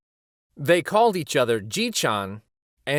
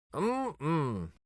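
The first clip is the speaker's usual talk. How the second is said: soft hum; 9 dB quieter than usual. Acted. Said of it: "He began to hum a tune".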